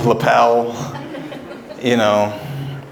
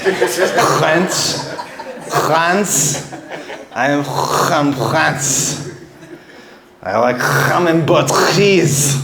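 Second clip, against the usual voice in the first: mock French accent; 3 semitones higher, slower than usual.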